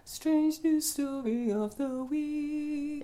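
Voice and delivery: high, slow sung-out announcer voice; high drawn-out announcer voice. Pretend. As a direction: stretch it out high like a show jingle. Jingle-style show segment announcement.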